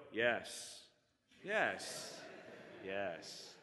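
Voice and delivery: low, soft, drawn-out mock-wise yes; goofy sage nodding. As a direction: play the bearded friend pondering with dry humor. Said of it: "dry, goofy humor".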